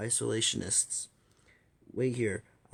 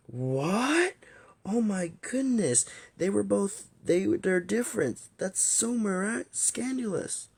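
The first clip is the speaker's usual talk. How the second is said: high-pitched, hammed-up gasp of disbelief; scandalized shock; 10 semitones higher, 4 dB louder, slower than usual. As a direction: squeal it out, shocked by juicy gossip. Acted shock at lovers gossip.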